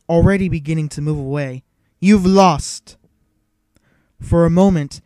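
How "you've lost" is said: loud, defiant call. A narrator's reading. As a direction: call your victory across the room. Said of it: "she called out to him".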